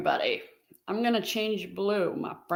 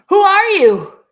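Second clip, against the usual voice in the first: surprised; 11 semitones higher, 12 dB louder than usual. Real, not acted. Greets unknown viewer loudly.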